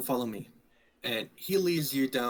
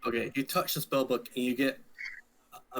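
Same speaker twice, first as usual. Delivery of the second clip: ordinary delivery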